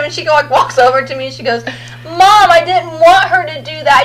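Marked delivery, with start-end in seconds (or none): 2.0-4.0 put-on slow, tearful child's plea; little daughter tearfully telling mom; imitated crying child. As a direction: plead through mock tears. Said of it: "And she's just crying".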